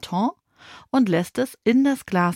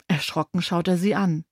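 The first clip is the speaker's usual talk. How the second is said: usual speaking voice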